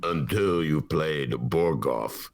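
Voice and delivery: put-on low, slow Russian-accented chess player. Russian player warns the chess genius, low Russian accent. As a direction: drag it out deep in a Russian accent. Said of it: "in my Russian accent".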